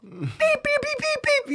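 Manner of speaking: very high, rapid vocal beeps; imitated censor bleep. Man censoring his own retold cursing, high mock bleeps. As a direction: squeak out a string of censor beeps.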